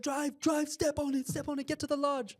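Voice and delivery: very high, loud, repeated urging; mock panic. Tourist to driver while fleeing, mock panic. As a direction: urge the driver on in comic terror.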